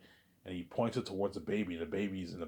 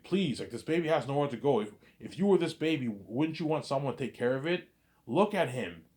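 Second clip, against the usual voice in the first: raised, mildly acted pleading; begging; 6 semitones higher, 3 dB louder than usual. Man urging the clerk to care for the baby, begging. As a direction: appeal to their sympathy, pitched up. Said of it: "he's begging her".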